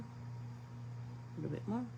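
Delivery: slow whisper, trailing away; soft slow whisper while mixing paint. Shown as a demonstration. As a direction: breathe it out slowly to the paint. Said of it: "adding water to palette".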